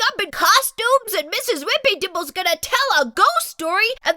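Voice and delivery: high, fast pleading rush; without a breath. Acted. Splat begging mom to take the spider, fast and breathless. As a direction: beg all in one breath. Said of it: "he added without taking a breath".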